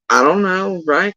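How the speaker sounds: ordinary talk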